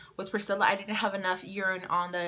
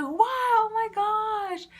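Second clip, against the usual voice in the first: high, loud, drawn-out amazement; imitated amazement; 13 semitones higher, 5 dB louder, slower than usual. Sister hearing she is pregnant, slow high-pitched mock astonishment. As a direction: stretch it out high as a thrilled sister.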